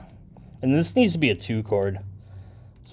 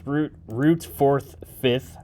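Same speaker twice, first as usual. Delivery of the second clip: slow musing recount of the chords; slow drawn-out count; 6 dB quieter, much slower than usual. Shown as a demonstration. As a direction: stretch each word out slowly. Repeats chord degrees slowly.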